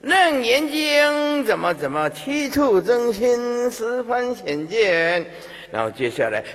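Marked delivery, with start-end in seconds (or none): 0.0-5.3 high, slow, drawn-out declamation; mock preaching. Lay Buddhist droning scripture at the dying, mock declaiming. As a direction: intone scripture like a mock lecturer.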